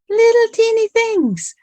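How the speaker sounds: very high, squeaky little voice; high-pitched, as if describing something minuscule; teeny-tiny voice. Shown as a demonstration. Voicing how tiny the things are.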